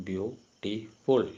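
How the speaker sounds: "beautiful" very slowly in three parts, beau... ti... ful; syllable by syllable. Shown as a demonstration. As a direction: break "beautiful" into three syllables with clear pauses. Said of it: "three syllables".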